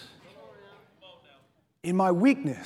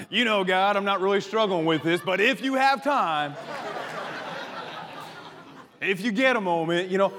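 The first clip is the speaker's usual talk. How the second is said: mock fake prayer. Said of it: "Trying to lie to God when he knows everything".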